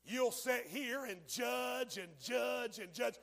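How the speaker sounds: high, loud, slow hammering sermon; shouted warning sermon; preaching at full force. Acted. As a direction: pound each line at the congregation.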